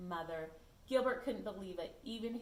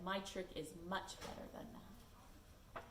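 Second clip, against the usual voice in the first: slow, softer knowing voice; hint at a secret plan; sly secret; 3 semitones lower, 8 dB quieter, slower than usual. Acted. Gilbert teasing Lewis, slow soft sly voice. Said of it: "Gilbert said".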